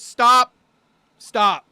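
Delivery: a sudden loud call, stop. Retold or imitated. Rock star calling the kids back, loud shout. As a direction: shout after the kids to halt.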